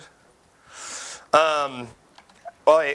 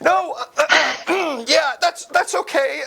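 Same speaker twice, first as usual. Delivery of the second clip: very high, squeaky mock-nervous voice; slower than usual. Host mocking own awkward reaction to guest, exaggerated nervous. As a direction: send up your own nerves way up high. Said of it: "hear me get nervous in my voice".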